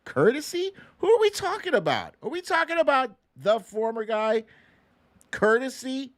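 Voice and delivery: high, incredulous questions. Real, not acted. Host mocking the letter's praise, incredulous and high. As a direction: scoff at the word, way up high.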